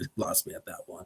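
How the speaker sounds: whispered, fast and breathy; whispered repeat. Retold or imitated. Repeating John's line in a hushed whisper. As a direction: whisper the line again quickly. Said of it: "He said".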